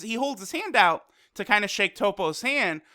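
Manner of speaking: normal talking voice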